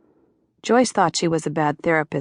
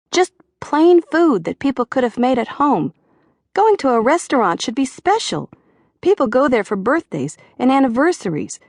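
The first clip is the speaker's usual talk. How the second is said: higher, louder whiny Georgia griping; a waiter venting, pitched up and pushing hard; moaning complaint; 9 semitones higher, 4 dB louder than usual. A narrator's reading. Waiter moans to David about the chef, higher and louder. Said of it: "he moaned".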